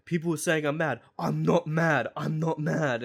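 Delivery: mock defensive rant. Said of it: "he was like".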